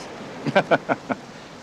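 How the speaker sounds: brief breathy chuckle; a dry little laugh at her joke. Acted.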